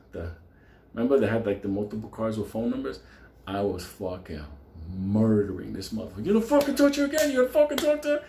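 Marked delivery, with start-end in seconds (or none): 6.2-8.2 screamed threats, much higher and louder; furious yell. Retold or imitated. Narrator yelling at the man he's beating, furious shout. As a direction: roar it while throwing punches.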